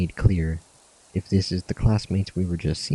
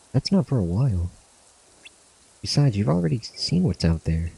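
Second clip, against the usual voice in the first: lightly acted friends saying goodbye, a bit higher; voicing game characters; 3 semitones higher, 3 dB louder than usual. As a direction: give the farewell banter a light acted read, slightly raised pitch. Reads farewell scene dialogue.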